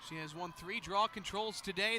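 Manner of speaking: usual speaking voice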